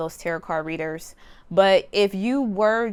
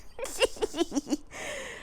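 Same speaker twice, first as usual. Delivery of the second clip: breathy laugh; 9 semitones higher, 9 dB quieter than usual. Real, not acted. Laughing at own stutter.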